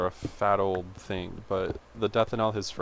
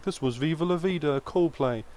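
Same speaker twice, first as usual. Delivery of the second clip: higher, mock British radio presenter accent; 6 semitones higher than usual. Playful British accent announcing the song on the car radio. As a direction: put on a posh British radio voice. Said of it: "I don't know why they're British".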